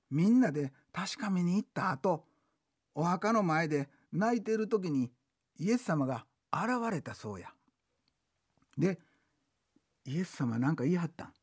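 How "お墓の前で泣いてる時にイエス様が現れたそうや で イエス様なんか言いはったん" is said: ordinary talk, nothing marked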